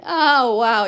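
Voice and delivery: loud burst of real amazement; amazed reaction. Real, not acted. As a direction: react loudly, as if caught by surprise. Reacting to a viewer's joke.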